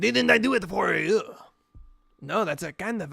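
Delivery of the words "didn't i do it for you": put-on French accent. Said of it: "deep French accent".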